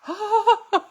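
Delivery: delighted laugh. Real, not acted. Laughing at bunnies.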